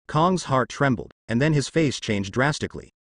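plain reading voice

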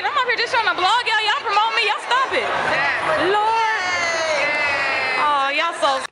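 high, loud gushing over the crowd. Real, not acted. Vlogger to cheering fans, high and loud gushing.

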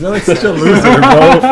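laughing. Real, not acted. Cracking up at friend's vlog.

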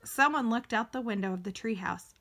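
plain reading voice

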